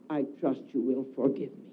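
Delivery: ordinary talk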